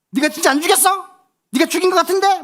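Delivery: high, loud, teasing accusation; mock grilling. Dad pressing his tearful youngest son, loud mock grilling. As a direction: keep badgering with mock suspicion. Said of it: "막 내가 막 다구쳤어".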